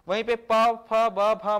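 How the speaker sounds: "प, फ, ब, भ" popped loud off the lips; loud lip sounds for "प, फ, ब, भ"; lip sound demo. Shown as a demonstration. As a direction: pop "प, फ" hard off the lips. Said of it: "ये होंठों से उच्चारित हो रहा है".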